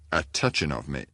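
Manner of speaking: plain reading voice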